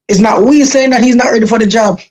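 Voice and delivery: ordinary talk, nothing marked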